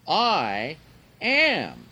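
I and AM each stressed hard, loud and drawn out; very emphatic. Retold or imitated. Jesus reveals himself to the woman, very emphatic. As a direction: hit I, pause, then AM high and loud. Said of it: "emphatic expression".

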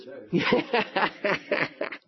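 short amused laugh; light laugh; chuckle. Real, not acted. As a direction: laugh at your own joke. Laughing at own joke.

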